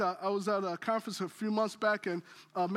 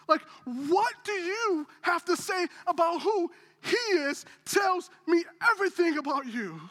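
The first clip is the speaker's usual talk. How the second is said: higher, louder preacher's challenge; preaching at full force; 7 semitones higher, 4 dB louder than usual. Real, not acted. Pastor pressing his church on who Jesus is, forceful preaching. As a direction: drive the question home to the congregation.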